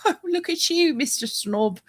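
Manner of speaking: high, teasing mock-posh voice; mock snob. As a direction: tease him as a snob. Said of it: "I was like".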